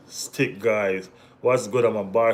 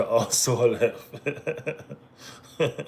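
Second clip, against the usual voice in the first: breaking into laughter; slower than usual. Real, not acted. Streamer reading out a club's news, cracking up laughing. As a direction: laugh breaking up the last word.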